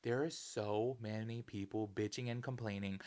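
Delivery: ordinary delivery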